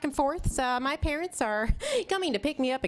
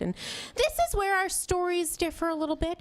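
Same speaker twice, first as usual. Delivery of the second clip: ordinary talk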